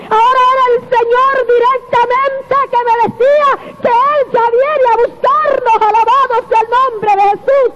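high-pitched rising preacher's exclamation; fervent preaching. Acted. Preacher to the brethren, fervent high-pitched praise. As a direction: lift the congregation with fervent praise.

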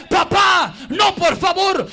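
loud desperate plea to his father; pleading prince. Acted. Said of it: "le dice el príncipe". (el príncipe ruega a gritos a su padre)

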